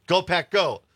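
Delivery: imitating a fan getting excited. Retold cheer escalating.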